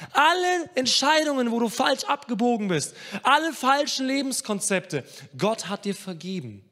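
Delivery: high, loud, insistent pulpit voice; full-force preaching. Acted. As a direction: drive each item home to the congregation.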